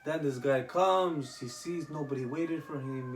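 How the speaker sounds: usual speaking voice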